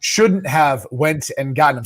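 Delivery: slow, deliberate enunciation; careful clarification. Shown as a demonstration. Guest clarifying his garbled phrase for co-host, slow and careful. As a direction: spell out each word slowly at normal pitch.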